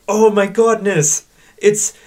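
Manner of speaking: high, loud, hammed-up amazement; mock shocked gasp. Two voyeurs peeping at a threesome, mock shocked. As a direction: gawp like scandalised old men. Frankenstein and assistant gawking.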